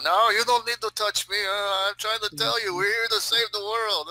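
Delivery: high, agitated Russian protest; pissed off Russian. Pretend. Russian arguing with cops on camera, pissed off. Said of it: "pissed off Russian".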